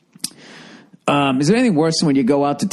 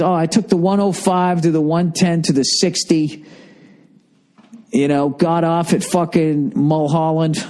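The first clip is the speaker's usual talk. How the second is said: Californian impression; 6 semitones higher than usual. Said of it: "And it's always".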